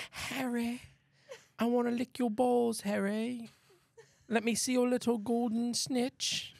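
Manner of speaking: sultry put-on British voice; British accent. Friend mocking Harry Potter porn, in a British accent. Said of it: "Why is she British?".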